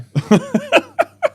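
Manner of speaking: very high-pitched giggling burst; laugh. Real, not acted. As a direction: crack up at your own answer. Laughs at own refusal.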